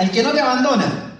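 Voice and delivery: high mock slogan call; imitated shouting. Audience answering the speaker's brand slogans, mock shouting. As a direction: call it out high, like someone in the crowd. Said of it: "en una grita".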